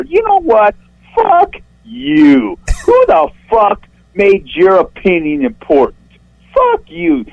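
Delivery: real indignant shrieking, cursing out an online critic; shrill, worked-up rant. Real, not acted. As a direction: voice shooting way up in pitch as he rages. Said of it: "when his voice goes up, like, three octaves".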